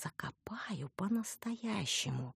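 soft, slow, half-whispered sweet threat; tender promise. A narrator's reading. Heroine to the hapless necrophile, promising tenderly. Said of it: "ласково пообещала я".

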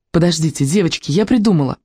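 plain reading voice

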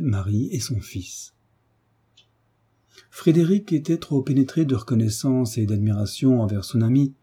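plain reading voice

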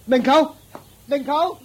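calling out loud and high after him; pleading call after the uncle. Acted. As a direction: call him back as he walks off.

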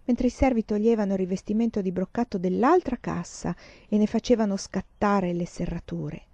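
ordinary delivery

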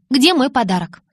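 high, loud, put-on eagerness; forced enthusiasm. A narrator's reading. Bella asks Jacob for her present, forced enthusiasm. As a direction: demand it with feigned excitement. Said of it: "Вымученный энтузиазм в голосе".

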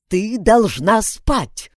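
slow, loud, threatening command; menacing order. Acted. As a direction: lay down the law, slowly. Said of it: "грозно сказала женщина".

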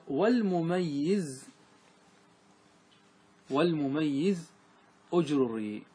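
very slow, drawn-out chant of the verse; verse recitation. Shown as a demonstration. Teacher chanting the verse line slowly to students. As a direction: stretch each word of the verse.

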